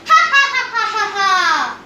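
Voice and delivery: loud high burst of laughter; hearty laugh. A narrator's reading. Narrator laughing at Lady Tremaine turned pumpkin, loud and high. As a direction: laugh at the joke.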